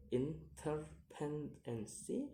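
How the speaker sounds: "interdependency" split into syllables, rising at the end; separated syllables on "interdependency". Shown as a demonstration. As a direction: sound out "interdependency" piece by piece. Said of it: "count the word syllable backwards".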